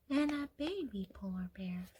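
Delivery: put-on soft hushed whisper to a child. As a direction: whisper it like a secret. Softly pointing to baby bear.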